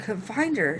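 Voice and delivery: usual speaking voice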